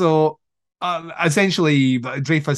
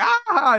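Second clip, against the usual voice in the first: very high, mock hysterical "ah"; high-pitched comic wail; giddy cry. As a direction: squeal it out in giddy horror. Acting out gassed Dreyfus's reaction.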